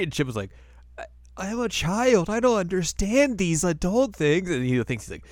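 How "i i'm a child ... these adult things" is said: put-on high, slow little-kid voice; innocent child. Shippo dodging Moroku's question in a high, slow child voice. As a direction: play dumb like a small child.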